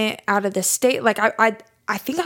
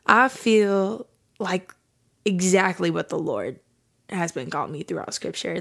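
ordinary talk, nothing marked

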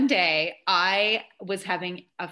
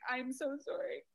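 soft, higher apology with rising end; retold bawling apology; 4 semitones higher, 12 dB quieter than usual. Bawling mom apologizing back to the secretary, soft and higher. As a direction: answer softly, a touch tearful. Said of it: "I'm bawling".

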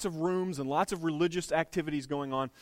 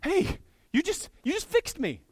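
amazed healed man; 13 semitones higher than usual. Retold or imitated. Healed beggar calling after Peter and John, much higher and amazed. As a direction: squeal it out in disbelief.

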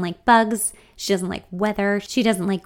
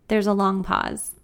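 usual speaking voice

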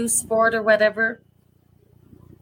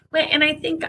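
normal talking voice

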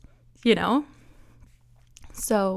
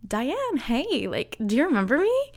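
higher, quicker overfriendly greeting; hey-remember-me chirp, pitched up and rushed; bright fake recognition; 6 semitones higher than usual. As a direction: act like an old friend. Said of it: "I'm like".

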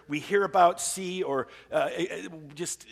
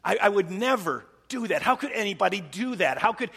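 indignant; 5 semitones higher, 4 dB louder than usual. Retold or imitated. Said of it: "read that headline saying".

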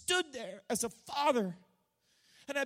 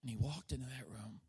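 much softer, lower, sinking to a whisper; hushed storytelling; 10 semitones lower, 7 dB quieter, faster than usual. Acted. Preacher telling Jesus raising the girl, hushed. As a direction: draw them in with a near whisper.

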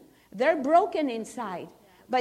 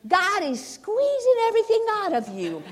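a church lady, higher and louder, warning her; church lady, raised voice; 9 semitones higher, 7 dB louder than usual. Retold or imitated. Church lady warning Sister Sandra, higher and louder. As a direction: say it like a blunt older church lady.

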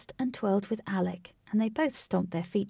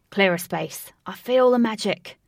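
loud call; 7 dB louder than usual. Acted. Alec calls out, clearing space.

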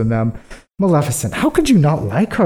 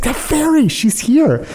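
much higher, breathless alarm; mock alarmed whisper-news; 12 semitones higher than usual. King warning the queen the old fairy came, much higher and alarmed. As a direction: blurt it out like dreaded news. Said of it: "She's like".

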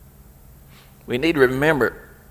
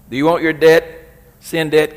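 ordinary talk, nothing marked

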